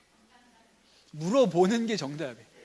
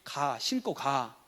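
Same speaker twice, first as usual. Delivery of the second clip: slow, lower-pitched order, acted; ordinary father; 4 semitones lower, 3 dB quieter, much slower than usual. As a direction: play a dad tersely sending his child off. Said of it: "이게 보통 아빠고요". (낮고 느리게 명령하는 아빠 연기)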